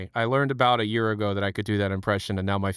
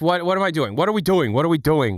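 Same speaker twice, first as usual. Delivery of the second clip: exasperated; 5 semitones higher, 4 dB louder than usual. Real, not acted. Outraged at the news.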